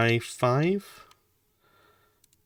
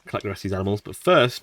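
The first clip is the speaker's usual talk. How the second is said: normal talking voice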